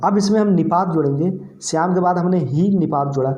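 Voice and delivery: ordinary talk, nothing marked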